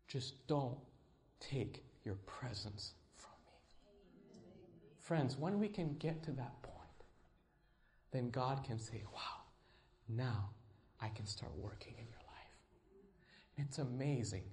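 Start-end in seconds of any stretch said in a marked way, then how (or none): 0.1-4.3 slow, softer plea to the Lord; hushed prayer. Acted. Preacher prays to the Lord in a slow hush. 9.1-12.2 whispered God. Acted. Preacher voices God answering the believer, whispered. Voicing God's reply.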